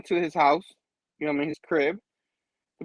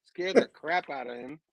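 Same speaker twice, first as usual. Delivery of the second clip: usual speaking voice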